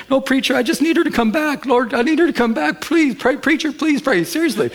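high, accented, pleading imitation; imitating crying plea. Pastor imitating the weeping man begging for his wife. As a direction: mimic a tearful man begging for prayer. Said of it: "And he's crying".

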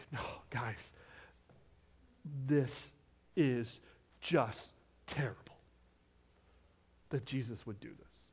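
dropped low and soft, slow and aghast; hushed mock horror. Preacher to congregation, hushed mock horror. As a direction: lean in and whisper the scandal. Preacher feigns shock at Jesus.

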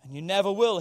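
loud, high, impassioned sermon peak; full-force preaching. Real, not acted. Preacher reciting today's song to the church, full-force preaching. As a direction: proclaim it loudly to the whole congregation.

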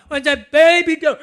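hammed-up comedian panic; Brian Regan bit. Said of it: "And he goes".

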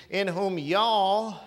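loud southern "y'all", drawn out; southern dialect. Shown as a demonstration. Preacher rewords scripture for his church in loud southern dialect. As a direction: lean on a long southern "y'all". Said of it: "if that was in the southern language dialect".

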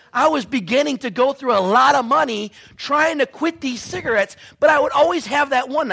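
loud, high, building preacher's story; a preacher climbing into full force; preaching at full force. Acted. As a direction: drive the story hard to the crowd.

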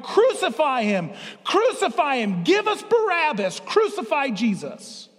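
mock crowd chant, pitched high; crowd crying out. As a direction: cry it out like a mob demanding it. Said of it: "stood in the streets and cried".

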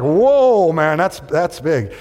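loud, high "whoa", then drawn out; mock amazement; awed students. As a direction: play stunned kids, slow and amazed. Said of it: "so they were like".

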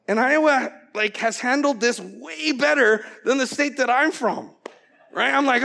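ordinary delivery

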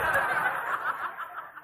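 high-pitched fake wail; hammed-up comic sobbing; mock crying. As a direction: bawl like a humiliated baby, badly faked. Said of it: "I started crying".

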